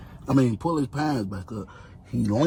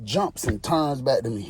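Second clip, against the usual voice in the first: ordinary delivery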